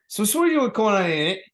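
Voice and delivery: high, loud put-on Cockney; Cockney accent. Shown as a demonstration. Doing the accent after saying they wouldn't, Cockney. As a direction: burst into mock Cockney. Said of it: "when I do a Cockney accent".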